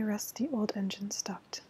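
ordinary delivery, nothing marked

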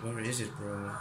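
hushed. Real, not acted. Sneaking alone, searching.

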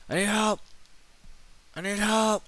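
high, loud cries for help; panicked yell. Real, not acted. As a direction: scream it up high. Screams for help in game.

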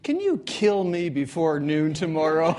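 loud, pleading punchline; desperate loud plea. Retold or imitated. Second coach begs the jailer to kill him early, loud plea. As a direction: beg loudly to be killed first.

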